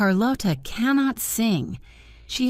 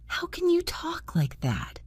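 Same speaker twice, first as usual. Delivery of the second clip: high unvoiced whisper; 12 semitones higher, 6 dB quieter than usual. A narrator's reading. Christine whispering fearfully to the hidden voice. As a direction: breathe it out, frightened. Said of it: "Her voice was unhappy and afraid".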